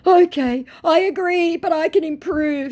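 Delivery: high, mocking put-on voice for the scammer; whiny high imitation of a chat scammer. Scammer's reply voiced in story.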